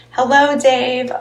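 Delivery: ordinary talk, nothing marked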